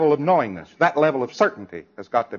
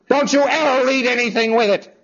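higher, louder scolding burst; exasperated outburst; 11 semitones higher, 5 dB louder than usual. Pretend. Instructor losing patience with a student, exasperated outburst. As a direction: snap at the student in frustration.

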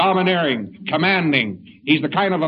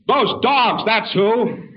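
mock angry reply; 5 semitones higher, 3 dB louder, much slower than usual. Angry man answers.

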